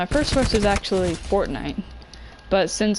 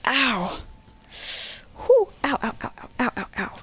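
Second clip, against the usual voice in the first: getting a leg cramp; 4 semitones higher than usual. Real, not acted. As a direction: whimper through the cramp.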